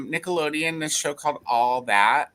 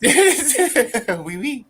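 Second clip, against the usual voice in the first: laughing mock French "oui"; a hammed-up French oui through laughter; cheeky Frenchman; playful French accent; 10 semitones higher, 4 dB louder than usual. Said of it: "you've been practicing this accent".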